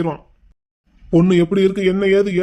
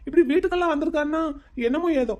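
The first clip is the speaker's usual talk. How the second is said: raised pitch, uneasy pleading; nervous; 5 semitones higher than usual. Acted. Young man excuses himself over girl's visit, nervous. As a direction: explain yourself with jittery unease. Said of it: "நர்வஸா இருக்கு".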